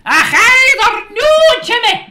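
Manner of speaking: very high, loud, laughing mock Scottish; mock Scottish accent. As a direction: squeal out a cartoon Scottish greeting. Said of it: "I can do it as a Scottish person".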